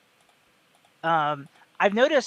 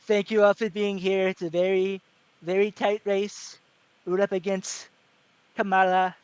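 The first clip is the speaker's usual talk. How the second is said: stump-speech Trump, halting phrases; Trump impression; 3 semitones higher than usual. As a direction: do Trump addressing a rally, pausing between chunks. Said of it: "that's kinda how he talks".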